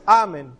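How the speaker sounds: AMÉN said AH-men; English amen. Shown as a demonstration. Teacher quoting what is heard in English, AH-men pronunciation. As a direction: open ah at the start of amén. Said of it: "hasta en inglés tú escuchas amén".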